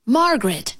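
a restrained narrator's version of a yell; mild yell. A narrator's reading. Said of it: "they both yelled together".

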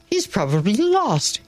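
bright. A narrator's reading. Man to the teacher about the missing child, bright. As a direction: look up with a bright idea. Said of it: "then looked up brightly".

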